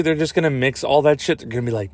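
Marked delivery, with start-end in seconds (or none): none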